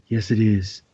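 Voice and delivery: low, quiet action-star deadpan; Steven Seagal. Retold or imitated. Co-host corrects the impression, low soft star voice. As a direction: answer low and soft, as a mock tough star. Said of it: "he did Steven Seagal perfectly".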